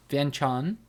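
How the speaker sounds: "Vientiane" in two syllables, vyen-tahn, rising; American pronunciation. Shown as a demonstration. Checking the shorter version, questioning two-syllable pronunciation. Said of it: "That's probably closer".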